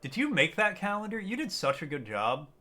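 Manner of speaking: normal talking voice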